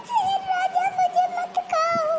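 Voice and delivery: crying mouse. A narrator's reading. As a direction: beg the lion like a small frightened mouse. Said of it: "वह जोर-जोर से रोने लगा". (ऊँची आवाज़ में रोता हुआ नन्हा चूहा)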